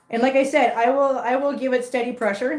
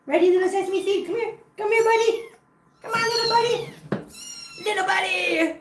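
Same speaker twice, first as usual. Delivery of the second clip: overwhelmed by cuteness; 11 semitones higher than usual. Real, not acted. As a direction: coax the tiny kitten sweetly. Coaxing a kitten to come.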